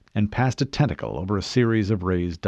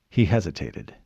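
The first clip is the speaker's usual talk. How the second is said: ordinary delivery, nothing marked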